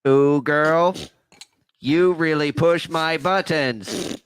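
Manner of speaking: slow, seductive robot voice; sexy robot voice. Pretend. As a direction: purr it like a flirty android. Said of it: "that was about as sexy as robot voice as I could do".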